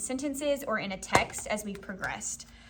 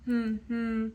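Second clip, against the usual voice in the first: two short even hums; humming. Shown as a demonstration. Teacher demonstrating syllable counting, humming. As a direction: hum one beat per syllable. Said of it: "Let's hum it".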